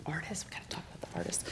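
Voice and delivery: quick excited whisper. Real, not acted. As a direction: whisper it like a secret aside. Aside, unvoiced and very soft.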